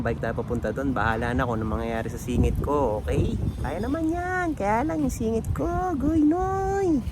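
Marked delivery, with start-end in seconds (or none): 3.6-7.0 much higher, silly squeak; squeaky high voice. Pretend. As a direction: joke it in a tiny high voice. Silly joking about sore groin. (matinis na boses)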